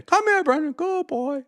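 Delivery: high, coaxing voice for a good doggy; praising a dog. Acted. Streamer reading chat that praises Brandon like a pet dog, high coaxing doggy voice.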